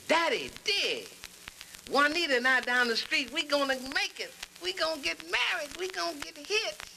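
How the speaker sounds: high, hip youngster's voice; excited son. Acted. Son telling Daddy he'll get married, excited hipster. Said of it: "he came back in. He says".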